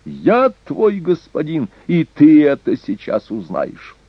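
high, imperious threat; angry cry. A narrator's reading. Young lord threatening a defiant vassal, angry cry. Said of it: "закричал Эрнак".

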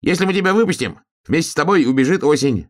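high-pitched little hare; animated storybook hare. A narrator's reading. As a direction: explain in a high, piping voice. Hare reasons with the trapped cub.

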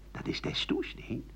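secretive whisper; whispered gossip. Retold or imitated.

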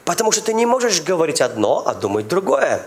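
ordinary delivery

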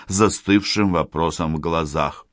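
usual speaking voice

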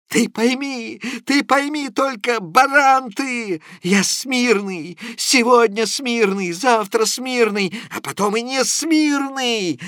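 a sick, muddled man insisting on his point; high-pitched, agitated rambling; Prokharchin's voice. A narrator's reading. Prokharchin argues feverishly.

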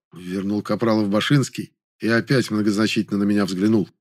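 usual speaking voice

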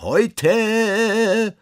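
very high, loud, long-drawn holler; drawn-out shout on a high held note; street cry. A narrator's reading. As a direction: cry it out like a roaming collector. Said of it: "und schreien".

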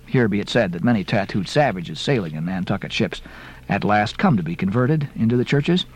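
plain reading voice

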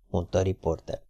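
ordinary delivery

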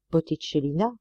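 ordinary delivery, nothing marked

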